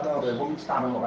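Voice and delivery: ordinary talk, nothing marked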